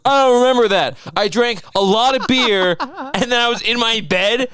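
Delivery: high, loud hammed-up distress; mocking co-host impression. Mocking co-host's drunken lost afternoon, loud high wail. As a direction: wail it, cracking up along the way. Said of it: "I'm making fun of you".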